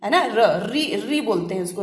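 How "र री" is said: ऋ pronounced री, not र; demo: say री for ऋ. Shown as a demonstration. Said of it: "उसका प्रोनाउंसिएशन होता है री". (ऋ का उच्चारण री)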